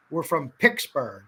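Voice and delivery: Picksburg said with a k sound for the t; Pittsburgh pronunciation. Shown as a demonstration. A local teaching viewers the name of Picksburg. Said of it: "That's how everyone says it here".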